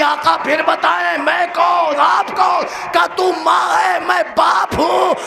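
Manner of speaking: shouted high, slowing at the peak; بلند آواز میں گریہ آمیز مرثیہ خوانی; full-force lament preaching. Acted. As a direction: climb into a wailing cry as the mother asks. Rising lament dialogue.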